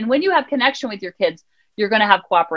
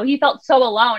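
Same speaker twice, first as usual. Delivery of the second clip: ordinary talk, nothing marked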